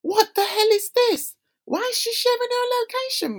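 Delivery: high, alarmed disbelief; mother startled by daughter's SOS alert, high and alarmed; panicked. Retold or imitated.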